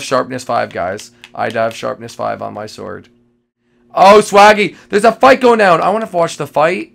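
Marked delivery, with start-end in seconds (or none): 3.9-6.9 excited shout. Real, not acted. Spots a fight.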